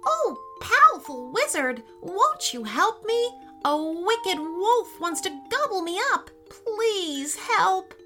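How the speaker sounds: high, pleading rabbit voice; panicked rabbit. Acted. Frightened rabbit begs the wizard for help, high and panicky. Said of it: "racing out of the wood in a dreadful panic".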